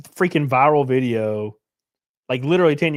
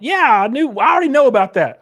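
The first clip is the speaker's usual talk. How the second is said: loud, high, impatient protest; raised-voice exasperation; exasperated retort; 8 semitones higher, 7 dB louder than usual. Real, not acted. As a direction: cut in loudly, insisting you already knew. Arguing back at a chatter.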